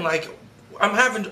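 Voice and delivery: ordinary delivery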